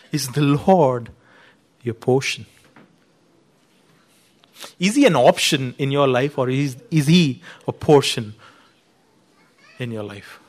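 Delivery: quiet appeal. Real, not acted. Soft pastoral appeal.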